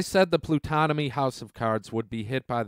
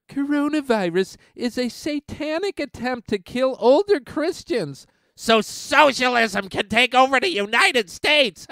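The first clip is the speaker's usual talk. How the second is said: high, hammed-up preacher voice; mocking Perry Stone; 9 semitones higher than usual. Vlogger mocks a TV pastor in a high put-on preacher voice. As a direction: parody the pastor's claim, pitched up. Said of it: "Pastor Perry Stone said".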